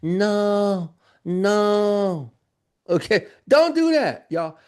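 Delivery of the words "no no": drawn-out whiny no; mock whining. Showing students how their complaints sound, mock whining. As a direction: moan it like a defeated beginner. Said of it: "that's how you sound".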